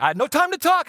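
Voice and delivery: high-pitched mock panic; retold panic. Late dad rushing out past the kids, mock panicked. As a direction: blurt it high, as if dashing off.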